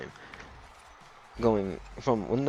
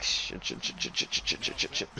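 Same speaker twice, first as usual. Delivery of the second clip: rapid whispered stream of cursing; retold tense whisper; 9 semitones lower, 6 dB quieter than usual. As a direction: act out hushed panic, whispering on repeat. Retells own tension while driving.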